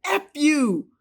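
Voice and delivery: high, loud cursing retort; angry shout. Retold or imitated. Husband cursing at wife, high loud shout. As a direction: spit it at her in rage. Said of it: "And he says".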